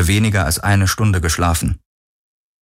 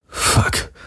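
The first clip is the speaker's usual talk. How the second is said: breathy unvoiced curse; whispered curse on an exhale; breathed. A narrator's reading. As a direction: breathe it out, just woken in a sweat. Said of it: "hauchte er".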